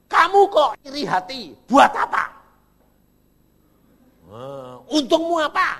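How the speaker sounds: loud, high scolding of yourself; rebuking yourself. Shown as a demonstration. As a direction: scold your own jealous heart, loudly. Preacher rebukes his own soul. (memarahi jiwa sendiri dengan keras)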